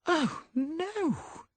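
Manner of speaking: high, shocked oh no; dismayed cry. A narrator's reading. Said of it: "cried Molly Davidson".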